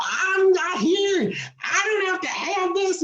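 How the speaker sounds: high crying shout of a frantic patient; slow crying shout of a woman refusing her procedure. Retold or imitated. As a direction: wail it out like a panicking patient losing it. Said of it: "she was losing her dang mind".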